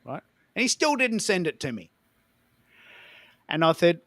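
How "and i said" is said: ordinary delivery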